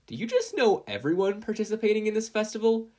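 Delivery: mock-incredulous ribbing; joking question. A narrator's reading. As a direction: tease her playfully. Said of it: "James jokingly questioned".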